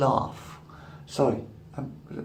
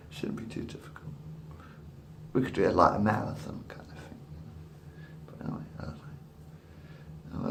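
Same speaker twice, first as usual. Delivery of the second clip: whispered musing; 7 semitones lower, 13 dB quieter than usual. Real, not acted.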